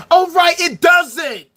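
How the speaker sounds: slow, loud sarcasm; sarcastic. Real, not acted. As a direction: snap back with slow mock realization. Answering his own question sarcastically.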